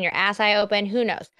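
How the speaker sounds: ordinary delivery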